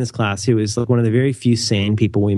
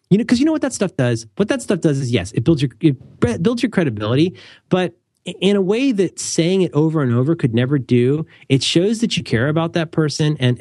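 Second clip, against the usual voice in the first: usual speaking voice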